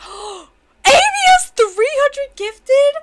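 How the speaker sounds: high, loud disbelief; shocked shout. Real, not acted. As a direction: gasp and shout it out. Shocked at her badge.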